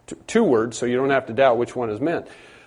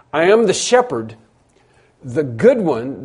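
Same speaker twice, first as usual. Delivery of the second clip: pause, then lean on "good" in "the good one"; stress on good; 2 semitones higher, 4 dB louder than usual. Shown as a demonstration. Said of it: "the emphasis is upon good".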